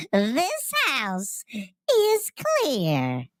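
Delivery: a hint of a creepy old medium; lightly voiced old woman, slow and drawn out; little old lady voice. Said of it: "it's hard to do that voice".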